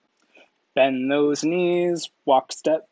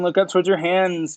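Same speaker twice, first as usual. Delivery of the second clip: ordinary talk, nothing marked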